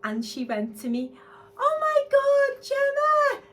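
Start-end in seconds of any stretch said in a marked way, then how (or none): 1.6-3.4 high, loud delighted squeal of a name; excited squeal. Retold or imitated. CEO spotting Gemma outside, delighted high squeal. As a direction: shriek the greeting like meeting a star.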